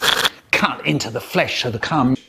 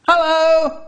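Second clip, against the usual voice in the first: calling out; 14 semitones higher, 6 dB louder than usual. Acted. Dad calls through locked door.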